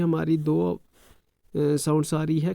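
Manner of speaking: ordinary talk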